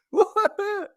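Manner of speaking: high-pitched, incredulous laughing squeal; hysterical laughing. Retold or imitated. Crash victim laughing on the pavement, acted hysterical high laugh. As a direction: re-enact a giddy, hysterical laugh. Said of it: "I'm, like, laughing hysterically".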